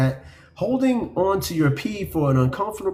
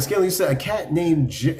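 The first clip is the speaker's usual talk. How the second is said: usual speaking voice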